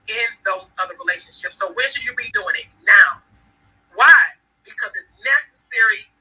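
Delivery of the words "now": "Now." punched out much louder, one sharp beat; emphatic now. Real, not acted. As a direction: hit "Now." like a command. Answering her own question with force.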